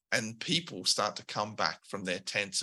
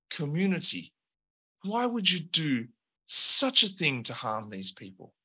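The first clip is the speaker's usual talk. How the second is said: reproachful Al; 5 semitones higher than usual. Acted. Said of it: "Al turns ... and says".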